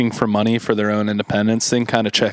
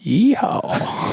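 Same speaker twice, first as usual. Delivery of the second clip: high cowboy holler; cowboy yeehaw; 10 semitones higher than usual. Pretend. Mock cowboy call.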